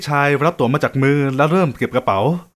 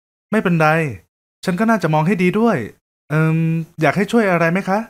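put-on kind young woman; 2 semitones higher than usual. Woman character voice.

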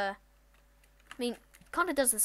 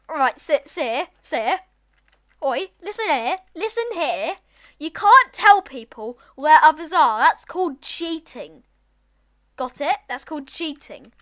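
stern telling off; 3 semitones higher, 9 dB louder than usual. Real, not acted. Lecturing the cheating player.

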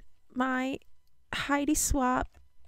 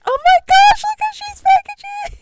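excited squeal; 9 dB louder, faster than usual. Real, not acted. Opening a gift package.

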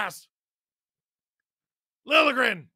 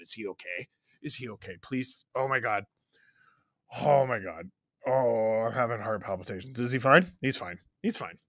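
worried; 10 semitones lower, 3 dB quieter, faster than usual. Real, not acted. Worried about injured player.

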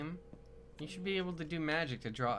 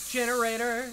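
excited shout; 10 semitones higher, 8 dB louder than usual. Real, not acted. Calling out discovery.

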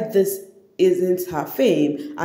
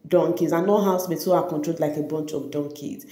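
normal talking voice

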